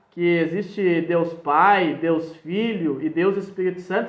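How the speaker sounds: usual speaking voice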